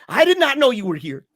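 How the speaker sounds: high, loud startled protest; retold astonishment. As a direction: exclaim it in surprise at the priest. Retelling surprised reply to priest.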